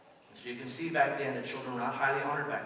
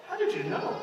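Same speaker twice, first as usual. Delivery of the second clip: high-pitched surprised lady; light mock falsetto. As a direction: squeak it out like a caught-out mother. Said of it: "And she goes".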